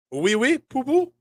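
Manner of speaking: high, rising mock-French chirp; token French accent. Shown as a demonstration. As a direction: play at speaking French, pitch climbing. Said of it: "that's my French".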